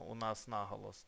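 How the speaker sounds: normal talking voice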